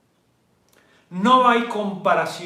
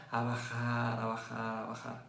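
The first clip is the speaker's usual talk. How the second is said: softer and lower each time; fading down; 7 semitones lower, 14 dB quieter than usual. Shown as a demonstration. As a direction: let the voice drop off. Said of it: "muestra cómo baja". (bajando hasta casi nada)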